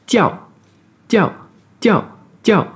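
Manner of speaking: "Tiao" short, falling hard each time; fourth tone drill. Shown as a demonstration. Teacher drills the falling fourth tone on tiao. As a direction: drop "Tiao" sharply, short and clipped. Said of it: "avec un O très bref, et un quatrième ton".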